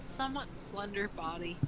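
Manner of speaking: ordinary delivery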